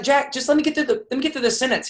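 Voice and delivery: frustrated plea. Retold or imitated. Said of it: "I would get so frustrated".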